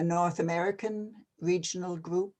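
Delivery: ordinary talk, nothing marked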